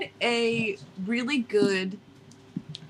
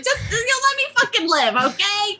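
high-pitched Jersey protest; Jersey accent; 9 semitones higher than usual. Acted. Host defending a shaky accent to friends, high protesting Jersey. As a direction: plead for slack in a put-on Jersey accent. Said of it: "what kind of Jersey accent this is".

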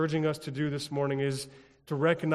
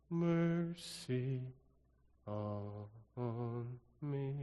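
very slow, soft, stretched Have mercy; drawn-out plea; 3 semitones lower, 8 dB quieter, much slower than usual. Shown as a demonstration. Pastor to congregation, another way to pray it, very slow soft plea. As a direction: stretch every word out, much softer.

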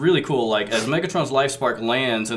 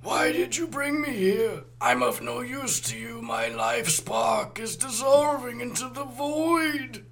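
high, soft, slow whimpering plea; pleading; 11 semitones higher, 6 dB quieter, slower than usual. Acted. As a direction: beg softly, high and slow. Cowering life spark pleads.

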